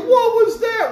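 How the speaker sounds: startled pirates. Acted. Pirates surprised by cannon shell.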